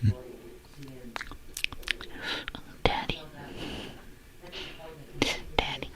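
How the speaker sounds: teasing unvoiced whisper; mock sexy whisper. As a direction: purr it in a hammed-up flirty whisper.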